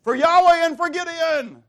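high, loud war shout; battle cry. Acted. Soldiers breaking pitchers and shouting, loud battle cry. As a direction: yell it as soldiers charging the camp. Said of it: "they're gonna shout out".

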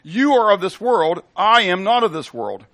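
slow, loud, ringing proclamation; preaching scripture. Acted. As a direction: declare each word to the room. Preacher reading Jesus' words.

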